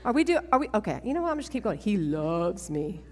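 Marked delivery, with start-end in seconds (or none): 1.8-3.0 slow, hushed and tender. Real, not acted. Speaker to crowd about the Lord's love, tender and slow. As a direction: let each word land softly.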